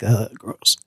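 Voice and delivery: low, soft mock disgust; disgusted ugh. Recalling the creepy character, a low disgusted groan. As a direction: groan it out like something gross. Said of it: "I was like".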